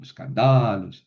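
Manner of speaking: escandalos slow, with a long accented DA; stress moved to DA. Shown as a demonstration. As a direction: stretch the DA of escandalos. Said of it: "tengo que mover el acento".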